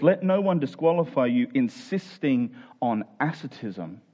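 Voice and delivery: normal talking voice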